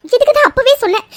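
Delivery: loud, high outburst of blame; distressed mother scolding the family; upset reproach. Acted. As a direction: cry out an I-told-you-so.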